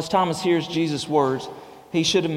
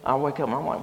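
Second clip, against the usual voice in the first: usual speaking voice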